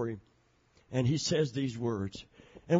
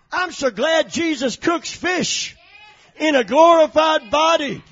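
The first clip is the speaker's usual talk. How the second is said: loud, high, ringing preacher's cry; full-force preaching; 9 semitones higher, 10 dB louder than usual. Acted. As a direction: preach it out to the whole church.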